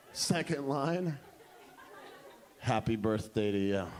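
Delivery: very low, understated announcement; dramatic reveal. Retold or imitated. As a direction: drop deep to unveil the line. Same line pitched second time.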